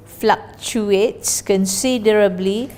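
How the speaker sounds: normal talking voice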